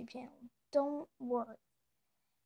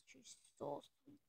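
soft gushing; 16 dB quieter than usual. Real, not acted. Gushing about her cat.

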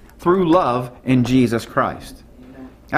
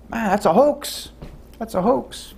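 scornful; 7 semitones higher than usual. Real, not acted. Preacher dismisses false teaching.

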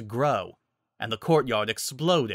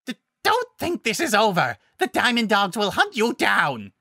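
loud, high, stammering threat; sputter, then roar a vow of revenge; furious beaten beast, loud and high; swearing revenge; 10 semitones higher, 5 dB louder than usual. Acted. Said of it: "he swore".

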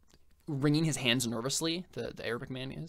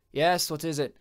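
clipped higher, louder call through the door; Haitian boss, voiced; 5 semitones higher, 7 dB louder than usual. Haitian calling toward the knock at the door, impatient. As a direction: answer the knock with impatience, raised voice.

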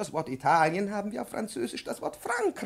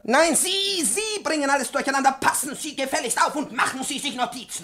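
higher, louder furious scold; angry scolding; 10 semitones higher, 7 dB louder than usual. Acted. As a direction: snap back at the careless pupil. Professor scolds the pupil.

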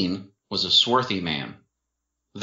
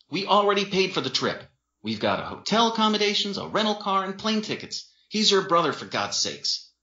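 high, pleading argument; exasperated boyfriend; 11 semitones higher than usual. A narrator's reading. Boyfriend arguing about the paid trip, exasperated. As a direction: reason urgently with raised pitch.

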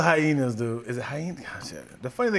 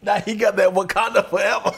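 shouted punchline; 5 semitones higher, 6 dB louder than usual. Real, not acted.

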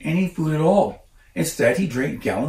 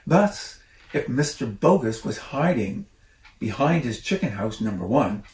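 usual speaking voice

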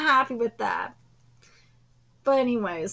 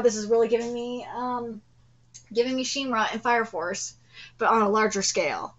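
normal talking voice

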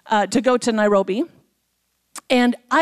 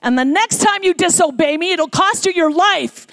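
stern warning, higher and louder; 6 semitones higher, 5 dB louder than usual. Retold or imitated. God warning the disobedient preacher, stern and loud. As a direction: lay down a final warning, sternly. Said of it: "in a very stern voice to me".

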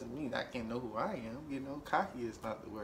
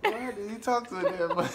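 laughing; 8 semitones higher, 7 dB louder than usual. Real, not acted. Repeats tease, laughing.

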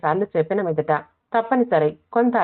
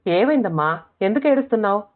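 ordinary delivery, nothing marked

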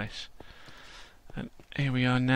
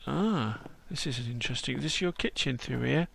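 ordinary talk, nothing marked

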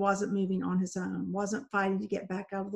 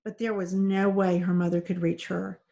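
ordinary delivery

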